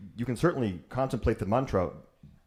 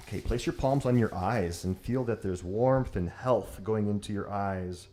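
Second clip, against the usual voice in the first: guided meditation; 2 semitones lower, 6 dB quieter than usual. Acted. Yoga teacher guiding students through hand warming, soft and calm. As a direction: ease the class inward, softer.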